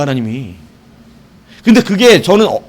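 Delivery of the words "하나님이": ordinary talk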